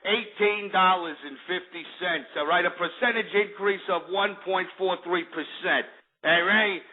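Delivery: market prices read in a hammed-up Jersey Shore accent. Host reading futures prices to the kids, mock Jersey Shore accent. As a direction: keep up the mock Jersey tough-guy talk. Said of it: "let me talk like this".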